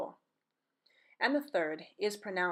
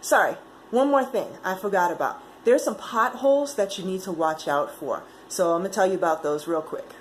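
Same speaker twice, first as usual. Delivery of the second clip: loud, hurried afterthought; much louder interruption after signing off; calling back urgently; 11 dB louder than usual. Acted. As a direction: call out as if rushing back with one more thing.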